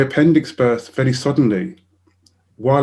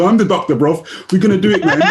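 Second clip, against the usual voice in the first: put-on higher, louder, breezy young doctor; 6 semitones higher, 5 dB louder than usual. Retelling the doctor's reply, loud breezy voice. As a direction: play the cocky young doctor.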